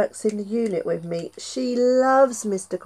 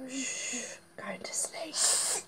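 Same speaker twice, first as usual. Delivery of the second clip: slow hushed whisper; 3 semitones higher, 15 dB quieter, much slower than usual. Acted. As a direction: whisper it to sleep. Snake put to sleep.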